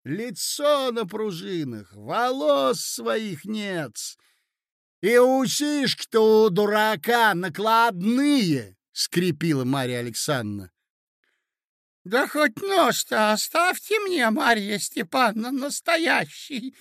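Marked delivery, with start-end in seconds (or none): 0.0-8.8 slow, high, drawn-out taunts; scornful jeering. Acted. Hostess exposing the prince's false parts, scornful. 12.0-16.8 put-on very high, slow old man's protest; stunned crying out. Prince stunned by the sudden revelations, crying out. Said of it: "вскричал князь, ошеломлённый".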